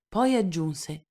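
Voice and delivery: usual speaking voice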